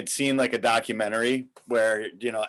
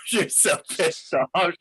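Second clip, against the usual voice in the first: ordinary delivery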